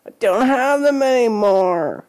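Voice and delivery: high, drawn-out mock wail; choked-out wail. A narrator's reading. A boy cornered about drugs, choked-out high wail. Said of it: "she choked out".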